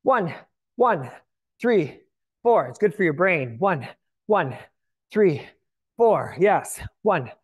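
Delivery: aerobics instructor. Acted. Workout cueing resumes.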